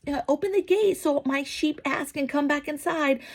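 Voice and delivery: high mocking voice of a gullible crowd; mock gullible sheep. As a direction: squeak it out like a dim, adoring follower. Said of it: "all the sheep are like".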